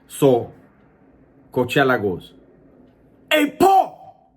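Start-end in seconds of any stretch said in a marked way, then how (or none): 3.3-4.3 high, booming holler across the yard; loud, high-pitched callout. Retold or imitated. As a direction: shout his name to get attention. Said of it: "Loud on the yard".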